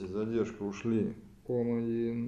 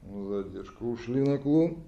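normal talking voice

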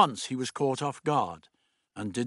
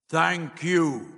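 higher, resonant thanks; booming voice; 6 semitones higher, 4 dB louder than usual. A narrator's reading. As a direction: intone it like an unseen presence. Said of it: "The voice boomed again".

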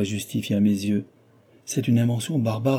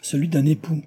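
proud, lifted reply; a husband's proud, higher retort; with pride; 6 semitones higher than usual. A narrator's reading. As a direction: throw it back with pride. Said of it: "Je lui répondis avec fierté".

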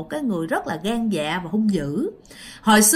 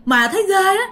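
high, agitated voice of a scared witness; acted fright, high and insistent; frightened retelling; 8 semitones higher, 4 dB louder than usual. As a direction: blurt out the scare, pitched well up. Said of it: "cho nên dì rất là sợ".

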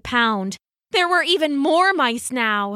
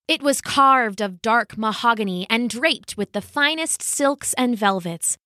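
ordinary delivery, nothing marked

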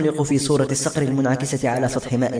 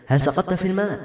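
higher-pitched curious question; 6 semitones higher than usual. A narrator's reading. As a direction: ask with raised pitch, wanting to know. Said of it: "سألته بفضول".

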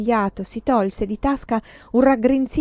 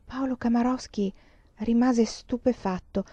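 ordinary delivery, nothing marked

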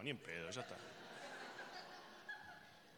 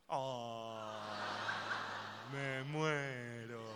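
low, very slow, drawn-out sigh; hammed-up languid swoon; mock swooning; 7 semitones lower, 3 dB quieter, much slower than usual. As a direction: melt away in exaggerated rapture. Acted swoon over dolphin kiss.

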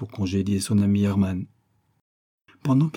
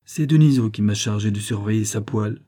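usual speaking voice

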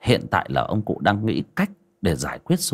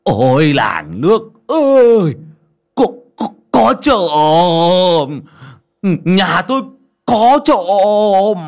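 high, loud drawn-out cry; long, wailing shout of an old man; yelling for help; 9 semitones higher, 8 dB louder, much slower than usual. A narrator's reading. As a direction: raise the alarm to the whole village. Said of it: "ngoạc mồm ra mà kêu".

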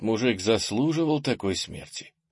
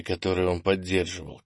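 plain reading voice

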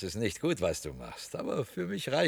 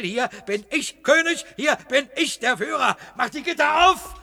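high, loud, building; triumphant shout; 10 semitones higher, 5 dB louder than usual. Acted. Cellar man locking the leader in, triumphant shouting. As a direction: crow over your caged prisoner.

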